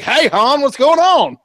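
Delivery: high, loud, breezy mock Leia voice; casual Leia imitation. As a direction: toss it off like a carefree girl greeting Han. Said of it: "she was like".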